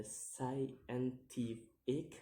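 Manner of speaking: "scientific" drawn out in separate syllables; "scientific" split up, slow and rising at the end. Shown as a demonstration. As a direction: sound out "scientific" slowly, piece by piece. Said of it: "count the word syllable backwards".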